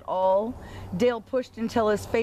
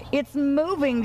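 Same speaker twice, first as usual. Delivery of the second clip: higher, mildly raised excited call; excited shout; 6 semitones higher than usual. Acted. Cow cheering as the stuck truck moves, mild shout. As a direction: call out that it's finally moving. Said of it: "shouted Bossy Cow".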